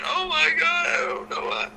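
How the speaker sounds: high, whimpering mock sob; high teary imitation of a crying colleague; imitating crying Goldilocks. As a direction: whine it out like a tearful, bewildered wrestler. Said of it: "Goldilocks was crying".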